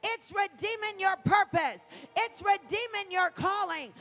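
loud, rising preacher's cry, shouting the last line; full-throated revival preaching; preaching at full force. Acted. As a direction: preach it out with everything. Preaching climax on redemption.

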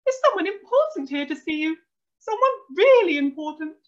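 high, loud, excited mum; flushed with excitement. A narrator's reading. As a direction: announce it in an excited squeal. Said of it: "Her face was flushed with excitement".

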